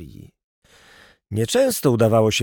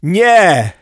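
high, loud cry of protest; one drawn, raised-voice cry; alarmed shout; 7 semitones higher, 8 dB louder than usual. Acted. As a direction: yell it out to stop someone.

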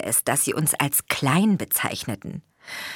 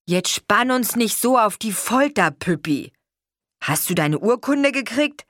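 curious father; 6 semitones higher, 3 dB louder than usual. A narrator's reading. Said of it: "fragte mein Vater neugierig".

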